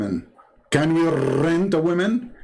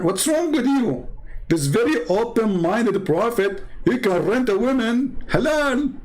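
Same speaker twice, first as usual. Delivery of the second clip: mock outrage; 8 semitones higher than usual. Mocking the caller's defense.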